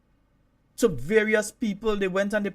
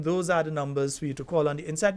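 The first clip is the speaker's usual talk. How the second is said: ordinary talk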